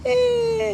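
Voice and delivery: high, drawn-out strained noise; Darth Vader. Acted. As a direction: let out a thin, long "eh". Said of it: "And then Darth Vader said".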